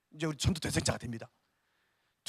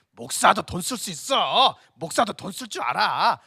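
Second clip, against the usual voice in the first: loud, slow, drawn-out declaration; punchy, emphatic show of pride; defiant boast; 8 dB louder, slower than usual. Acted. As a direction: proudly proclaim it, stretching the endings. Acts out showing pastors can spend.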